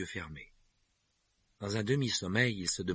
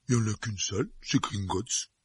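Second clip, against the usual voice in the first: giant voice. Acted. Hagrid voiced by narrator.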